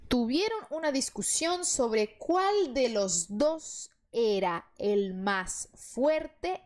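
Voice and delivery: slow, careful Spanish reading for pupils. Shown as a demonstration. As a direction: read the Spanish line slowly so learners follow. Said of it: "Vamos lá, essa aqui é mais longa". (leitura lenta e cuidadosa em espanhol)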